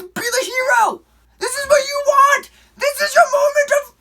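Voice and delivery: high, loud pumped-up cry; self pep talk. Retold or imitated. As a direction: hype yourself into heroism. Pumping himself up.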